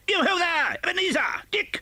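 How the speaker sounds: put-on rich, jolly old master's hail, pitched high; a hearty boss calling his lads; jovial voice. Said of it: "comfortable, oily, rich, fat, jovial voice".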